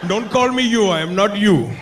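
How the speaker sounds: high, indignant mock Indian accent; huffy Indian-accented protest; offended Indian man. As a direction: snap back in a put-on Indian accent. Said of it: "they get offended".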